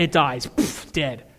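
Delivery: ordinary talk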